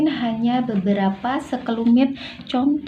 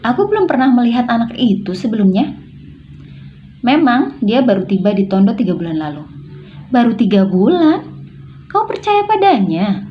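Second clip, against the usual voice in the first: incredulous questioning, louder, rising high at the end; puzzled surprise; 3 semitones higher, 4 dB louder than usual. Shown as a demonstration. Doubting trust in a child who arrived three months ago, astonished. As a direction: question it as if you can't believe it.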